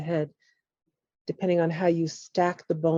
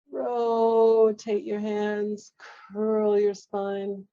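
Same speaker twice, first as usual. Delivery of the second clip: very slow, stretched vowels, higher voice; drawn-out cue; 3 semitones higher, 4 dB louder, much slower than usual. Shown as a demonstration. Teacher guiding a class through a spine curl, drawn-out cue. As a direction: stretch the words along with the movement.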